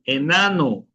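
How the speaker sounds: "enano" starting with a clear eh; initial E kept. Shown as a demonstration. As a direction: model "enano" as eh-NAH-noo. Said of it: "¿Por qué yo pronuncio como E?".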